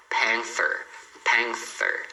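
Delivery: panther with tongue between the teeth on th; TH sound. Shown as a demonstration. Teacher models panther, th with tongue between teeth. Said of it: "Com a língua entre os dentes".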